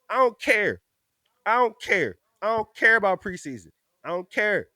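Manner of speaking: a fan insisting he does not care about preseason; emphatic. Real, not acted. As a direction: insist you don't care. Repeated emphatic refusal.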